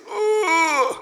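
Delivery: high, loud squeezed ooh; tight pressed "ooh". Shown as a demonstration. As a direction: press the cords hard on one ooh. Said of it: "press them together really hard".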